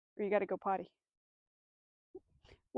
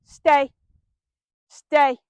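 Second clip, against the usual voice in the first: louder, slow firm stay command; 8 dB louder, slower than usual. Shown as a demonstration. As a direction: say it like a stop sign, firm and slow. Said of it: "a pretty firm tone".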